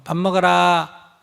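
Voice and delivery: slow, even call to eat; just tell them, slowly and calmly; a mother calmly telling her kid it's mealtime; calm announcement. Shown as a demonstration. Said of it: "이렇게 알리고".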